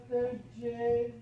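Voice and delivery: plain reading voice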